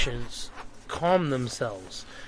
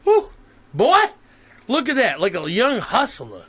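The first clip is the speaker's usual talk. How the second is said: excited hype; 6 semitones higher, 4 dB louder than usual. Real, not acted. Hyping up the ID photo.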